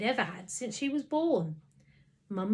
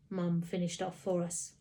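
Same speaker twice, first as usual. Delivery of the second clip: plain reading voice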